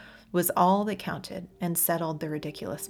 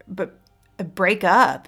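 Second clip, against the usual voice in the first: stammering, then a louder break up; nervous stutter; 10 dB louder, slower than usual. A narrator's reading. Joey stammering back the breakup news. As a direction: stumble over the words in shock. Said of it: "in a nervous stutter".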